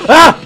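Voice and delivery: short, high loud shriek; startled scream. Real, not acted. Gamer hit by a jump scare, startled scream. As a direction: scream at a sudden fright.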